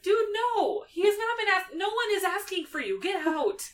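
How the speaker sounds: high, loud mock-angry brush-off; hammed-up irritated outburst; exasperated Hermione. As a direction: tell her off and shoo her away. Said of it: "And just like".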